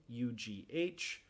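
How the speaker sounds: letters U G H said slowly; slow letter spelling; spelled letters. Shown as a demonstration. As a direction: spell out U, G, H one by one. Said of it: "con la U G H".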